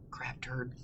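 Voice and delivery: whispered low "Crab turd" aside; cheeky whisper. Acted. Librarian joking to kids, whispered cheeky aside. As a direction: sneak it in as a whisper.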